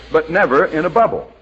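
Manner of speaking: normal talking voice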